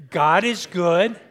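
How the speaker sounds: slow, loud call to the congregation; "God is good" drawn out loud for the crowd to answer. Shown as a demonstration.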